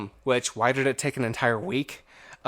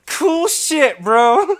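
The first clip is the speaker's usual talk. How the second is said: high and loud through laughter; amused, laughing sarcasm; 10 semitones higher, 7 dB louder, much slower than usual. Real, not acted. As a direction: laugh it out, slowly. Laughing at corporate call.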